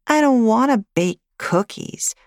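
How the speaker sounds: put-on high child voice, sulky; pouting little girl. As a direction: pout like a small girl refusing. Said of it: "Lily said with a pout".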